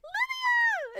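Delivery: very high, loud squeal of a name; sudden high fan scream; excited shriek. Real, not acted. Delighted at character appearing.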